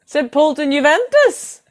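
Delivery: high-pitched, projected fixture call; high repeat to check. Real, not acted. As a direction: call "Saint Pölten, Juventus" out high.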